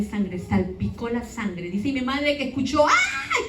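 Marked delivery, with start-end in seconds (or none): 2.9-3.5 sudden high-pitched cry of pain; quick high yelp; child's scream. Retold or imitated. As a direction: shriek it short and sharp, a girl's cry. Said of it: "que yo grité".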